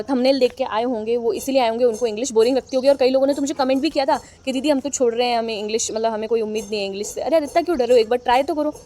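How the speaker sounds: usual speaking voice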